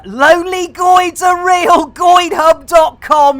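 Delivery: high, loud shouted advert; hammed-up shouted promo for the website; loud plug. Real, not acted. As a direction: bellow the web address like an ad.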